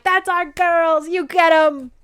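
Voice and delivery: seven men cheering their naked wives; proud cheer. Retold or imitated.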